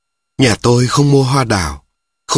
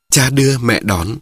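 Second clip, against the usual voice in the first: usual speaking voice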